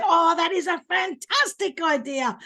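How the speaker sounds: high, emphatic burst of praise; enthusiastic. Retold or imitated. Speaker re-enacts praising an audience member's idea, enthusiastic. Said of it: "That's how I would have said it".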